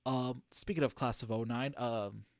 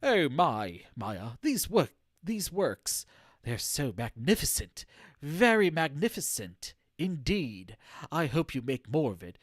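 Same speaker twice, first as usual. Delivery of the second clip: hammed-up admirer, louder, emphatic praise; gushing executive; 4 dB louder than usual. Streamer as an executive praising Maya's works at a fancy party, gushing. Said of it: "one of the executives approached me".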